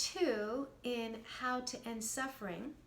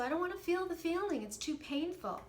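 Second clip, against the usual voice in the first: higher, faster, imitating a reluctant complainer; reluctant complaint; 6 semitones higher than usual. Coach imitating people who avoid painful feelings, higher and faster. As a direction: play the person who won't feel it. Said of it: "most people say".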